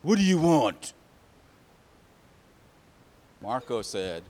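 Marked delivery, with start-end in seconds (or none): 0.0-0.9 loud, raised grumpy demand; acted irritated neighbor, loud and higher; angry neighbor. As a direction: snap at whoever knocked on your door. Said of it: "he said".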